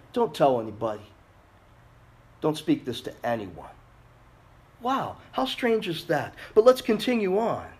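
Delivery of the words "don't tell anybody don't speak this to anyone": low, softer confidential caution; hushed warning. Acted. Jesus tells disciples to keep his identity quiet, hushed warning. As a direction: drop it low, as if guarding a secret.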